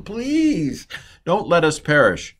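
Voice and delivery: high, stretched mock "please"; pleading. Sailors imploring God not to kill them, acted pleading. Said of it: "pleading him".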